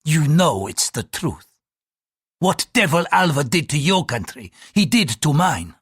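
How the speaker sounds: bitter priest driving it home; venomous. A narrator's reading. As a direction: throw the accusation at him with venom. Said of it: "he added with venom".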